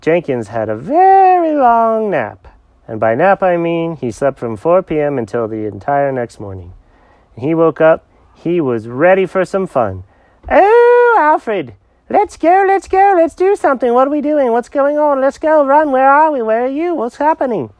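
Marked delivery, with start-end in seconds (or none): 0.8-2.5 "very long" stretched out high and loud; drawn-out emphasis. A narrator's reading. Narrator stretches words for emphasis. 10.5-17.7 high, loud, excitable cat voice; bursting with energy, pitched way up; hyper cat Jenkins. Acted.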